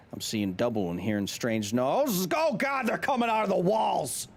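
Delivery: from measured read to high acted scream; building to panic. Letter writer hearing noises in the walls, building to panic. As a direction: let the terror erupt on "Oh, God".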